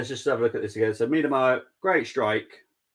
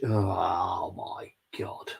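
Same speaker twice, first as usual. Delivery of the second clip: shocked whisper; 3 semitones lower, 5 dB quieter, much slower than usual. Real, not acted. Shocked by a chat comment.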